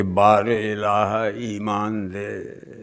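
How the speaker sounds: slow, low and soft supplication; humble prayer. Acted. Worshipper begs God for faith after morning namaz, hushed plea. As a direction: let the plea sink low and draw out.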